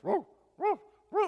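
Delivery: high, loud yipping woofs; imitated dog barking on command; short, high-pitched mock barks. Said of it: "Bark like a dog".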